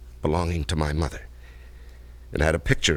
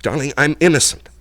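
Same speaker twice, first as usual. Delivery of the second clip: raised, pleading cry, higher and louder; desperate plea; 9 semitones higher, 7 dB louder than usual. Acted. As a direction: beg her to believe you, voice rising. Pleading his innocence to fiancée.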